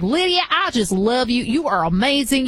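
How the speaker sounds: imitated gushing Southern fan; thick Southern accent. Fan from the South gushing to the host, imitated thick Southern accent. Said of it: "with the really thick Southern accent".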